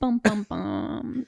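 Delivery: slow mock dramatic "bum, bum, bum"; dramatic sting. Friend mocking the stairs scene with a slow dramatic sting. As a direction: hum a slow, hammed-up movie sting. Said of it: "Hella dramatic".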